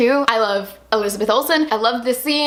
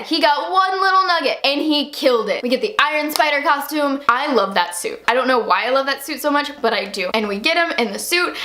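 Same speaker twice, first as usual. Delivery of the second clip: ordinary talk, nothing marked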